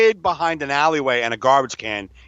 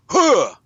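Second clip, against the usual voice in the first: sudden sharp "Huh!" higher and louder; jump scare shout; 9 semitones higher, 6 dB louder than usual. Retold or imitated. Son jumping out of the alley, sudden shout. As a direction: leap out with a quick bark. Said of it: "It's like".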